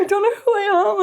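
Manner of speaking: very high, squeaky mock wail; mock distress. Repeating her confession to her laughing friend, mock distress. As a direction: ham up your own identity crisis.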